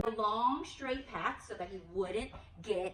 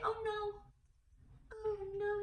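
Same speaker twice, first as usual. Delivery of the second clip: mock dismay; 11 semitones higher, 3 dB quieter than usual. Shown as a demonstration. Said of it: "Can you say".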